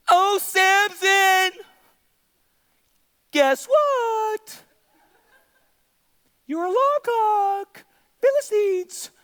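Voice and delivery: high, drawn-out teasing call; singsong Delilah. Acted. Preacher plays Delilah waking Samson, high teasing call. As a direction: stretch it out, high and hammed-up.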